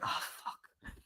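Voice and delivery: breathy whispered dismay; whispered groan. Retold or imitated. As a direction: mutter it under your breath, resigned. Friends groan at her question.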